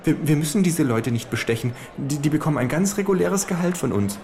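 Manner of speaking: high falsetto of a small fat lobbyist. Acted. Lobbyist lecturing the kangaroo at the buffet, high falsetto. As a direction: squeak it out like a nervous little fat man. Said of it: "mit Fistelstimme".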